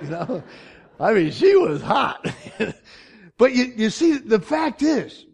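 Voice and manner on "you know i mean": normal talking voice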